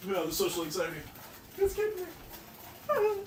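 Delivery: rising into a high squeak; mock anxious. Baker imagines guests judging cake, mock anxiety. Acts out mounting social anxiety.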